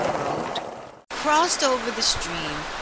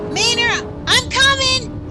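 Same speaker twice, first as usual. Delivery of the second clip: high, loud call; loud reassuring call; yelling; 7 dB louder than usual. A narrator's reading. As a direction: shout to your scared friend. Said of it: "he yelled".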